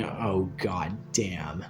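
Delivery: slow, low, whispered dread; hushed, drawn-out groan of dismay; dismayed muttering. Retold or imitated. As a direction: whisper it slowly, heart sinking. Dreading a busy shift, thinking to himself.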